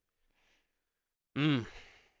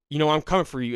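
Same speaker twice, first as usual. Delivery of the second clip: loud, raised prank-call threat; threatening prank caller; 4 semitones higher, 8 dB louder, faster than usual. Retold or imitated. Prank caller ending the call with a threat, loud mock menace.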